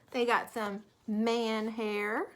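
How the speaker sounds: usual speaking voice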